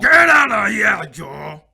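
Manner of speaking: loud furious dismissal; angry shout. Acted. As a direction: yell him out of the room.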